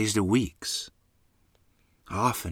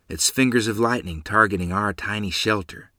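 plain reading voice

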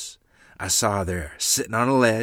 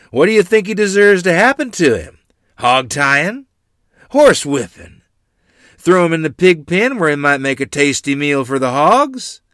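loud, raised rabble-rousing call; rousing shout; 7 semitones higher, 10 dB louder than usual. A narrator's reading. Great-uncle asking the crowd for a punishment, calling out. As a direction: put each punishment loudly to the crowd. Royal rouses the crowd against Afton.

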